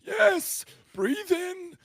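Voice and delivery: high shouted breathe in, hammed up; villain urging the kid to inhale, high and loud; manic villain shout. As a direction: yell it like a crazed cult leader.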